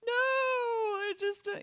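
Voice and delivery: very high, slow, put-on dithering voice; mocking a reluctant parent who stalls; mock hesitant parent. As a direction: squeak out the excuse, slowly trailing off. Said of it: "people out there who are like".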